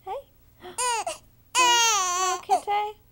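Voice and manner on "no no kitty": hammed-up sobbing cry, much louder; theatrical fake sorrow; mock gasp and wail. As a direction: play at heartbreak for the baby. Mock grief over lost kitty.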